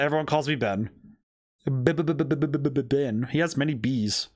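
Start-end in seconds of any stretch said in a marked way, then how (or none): 1.6-3.3 lightly voiced nervous stammer on the name; stuttering nervous man. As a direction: stumble over the B again and again, a hint of panic. Voicing the nervous ventriloquist Ben.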